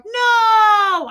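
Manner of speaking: a high, loud mock wail of dismay; shocked cry. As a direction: cry out as the whole chat at once. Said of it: "all of my mods in the chat were like".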